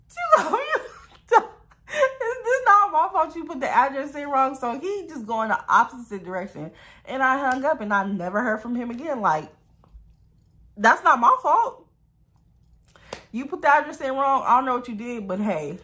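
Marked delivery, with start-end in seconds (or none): 0.1-4.6 high-pitched retort through laughter; squeaky, giggly self-defence; laughing protest. Retold or imitated. Retold retort to his yelling, laughing. 10.8-14.4 high, loud protest; loud squeal of innocence; indignant denial. Real, not acted. Emphatic denial of blame.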